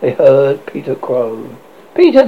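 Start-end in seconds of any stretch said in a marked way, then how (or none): 1.9-2.2 high, loud call; cried out. A narrator's reading. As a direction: call the name out sharply upward. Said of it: "they cried".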